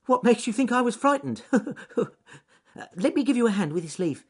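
high, quick, loud, with nervous giggle; Ernest Fluffy Rabbit. Acted. Rabbit denying fear to spider, high and hurried. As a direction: laugh off the fright in a rabbit squeak. Rabbit laughs off his fright.

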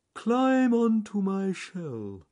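very slow, high, loud drawl; John Slow Tortoise. Acted. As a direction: stretch every word as a ponderous tortoise. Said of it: "he said".

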